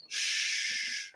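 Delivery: drawn-out, voiceless hush; long soft shush. Real, not acted. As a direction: shush the crying dog softly. Hushing the crying dog.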